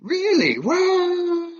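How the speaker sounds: real high-pitched surprise; astonished disbelief. Real, not acted. Podcaster amazed to learn who the actor is, stunned. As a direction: let the voice leap up high in amazement.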